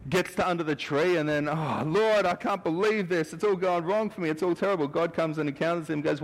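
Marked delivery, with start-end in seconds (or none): 1.5-4.9 high, whiny lament; despairing Elijah. Retold or imitated. Elijah under the tree moaning to the Lord, despairing. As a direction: moan that it all went wrong.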